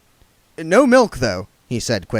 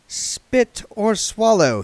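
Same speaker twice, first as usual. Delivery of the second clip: slow inner thought; 11 semitones higher, 4 dB louder, much slower than usual. A narrator's reading. William thinking, slow.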